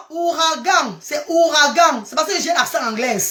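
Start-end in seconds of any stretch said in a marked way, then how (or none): 0.1-2.0 "ouragan" spelt out slowly, ou-ra-gan; corrected pronunciation. Shown as a demonstration. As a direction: say "ouragan" deliberately, nasal -gan at the end. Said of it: "je ne prononce pas bien". (« ouragan » bien articulé, ou-ra-gan)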